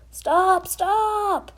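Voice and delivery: high, loud cry of stop; shouting. Acted. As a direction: call out to halt the pram. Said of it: "shouted Morty".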